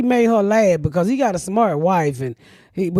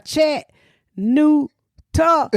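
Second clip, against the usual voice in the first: Chat, new, talk, each sounded out separately with pauses; sounding out the sign slowly; syllable breakdown; 6 semitones higher, much slower than usual. Shown as a demonstration. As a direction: spell out the syllables one by one. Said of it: "how to break it down".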